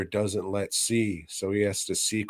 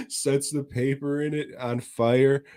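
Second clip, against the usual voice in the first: normal talking voice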